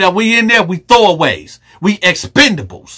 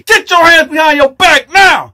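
commanding cop; 10 semitones higher than usual. Retold or imitated. Police officer arresting him.

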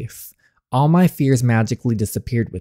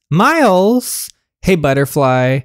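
a higher, louder call with a rising end; 5 semitones higher, 5 dB louder, slower than usual. Acted. Gianna calls Miles again, louder and higher. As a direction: call out to him again, more urgently.

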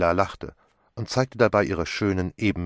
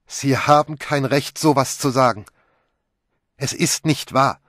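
higher, louder protest; indignant outburst; 6 semitones higher, 9 dB louder than usual. A narrator's reading. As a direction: flare up against the accusation. Accused woman flares up at Poirot. (empört und laut protestierend)

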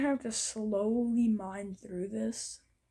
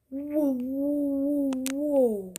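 louder, drawn-out whoas; amazed whoa; 5 dB louder, much slower than usual. Real, not acted. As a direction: gape at the find. Finding a strange building.

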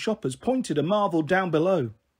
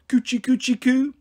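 put-on higher sing-song coo at a baby; baby talk; 7 semitones higher, 4 dB louder than usual. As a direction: tease her like a toddler with a coo. Mum teasing her like baby.

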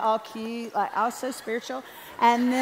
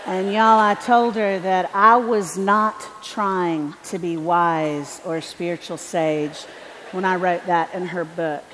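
building preaching; 6 dB louder than usual. Acted. Preaching at full force.

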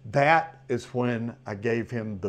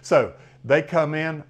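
usual speaking voice

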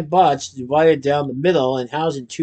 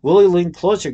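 ordinary delivery

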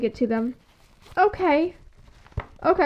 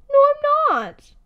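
panicky denial; 10 semitones higher, 6 dB louder than usual. Acted. Refuses reassurance, panicking.

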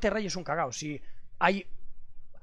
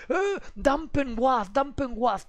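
higher, slow taunting chant; mock chat spam; 6 semitones higher, much slower than usual. Streamer mocking chat spam, slow taunting chant. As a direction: drag it out like chat spamming.

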